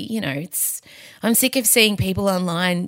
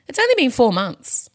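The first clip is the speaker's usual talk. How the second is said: ordinary delivery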